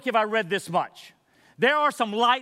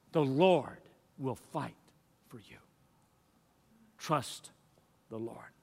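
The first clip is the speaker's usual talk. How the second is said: much softer, lower and slow, almost breathed; hushed intimate; 7 semitones lower, 6 dB quieter, slower than usual. Acted. Pastor comforting the church, soft and slow. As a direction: draw the church in close with a hush.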